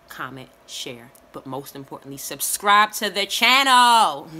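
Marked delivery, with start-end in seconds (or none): 2.2-4.3 slow and loud, stretching channel; loud drawn-out plea. Acted. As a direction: belt out the subscribe request. Emphatic closing call to subscribe.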